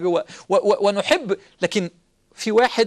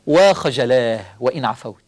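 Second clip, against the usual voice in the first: lower, slow, ashamed quote; 10 semitones lower, 5 dB louder than usual. Acted. Preacher quoting Sufyan al-Thawri's weeping words, low and slow. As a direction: let the words sink with shame.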